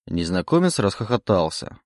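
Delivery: plain reading voice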